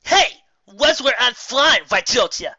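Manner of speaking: loud, high irritated yell; gruff irritated call. Acted. Flier yelling at the pony she crashed into, irritated. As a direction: snap at her as you speed past. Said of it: "A rather gruff and irritated voice called".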